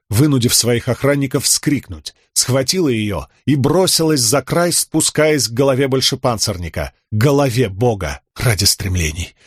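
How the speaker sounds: plain reading voice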